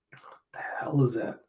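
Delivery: a hushed aside at something weird; quietly confused; baffled whisper. Real, not acted. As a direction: whisper it, puzzled. Baffled by a panel.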